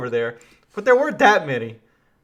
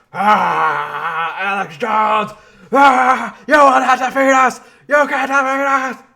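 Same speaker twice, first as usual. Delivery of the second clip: hammed-up yelling, much higher and louder; mock raging shout; 5 semitones higher, 7 dB louder, slower than usual. Mocking a man who looks like he's raging, in a hammed-up shout. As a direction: bellow it like a raging madman.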